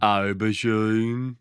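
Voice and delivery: AUBERGINE very slow and drawn out in Brummie; slow Birmingham drawl on "Aubergine"; Brummie accent. Acted. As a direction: stretch "Aubergine" out like a dopey Brummie. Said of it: "say aubergine".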